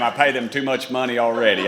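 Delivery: usual speaking voice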